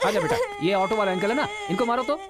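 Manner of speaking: high, delighted praise; cheering on. Acted. Father gleefully cheers his son hitting the auto driver, high excited praise. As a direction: egg the boy on gleefully.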